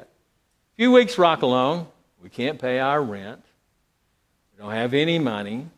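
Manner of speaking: ordinary talk, nothing marked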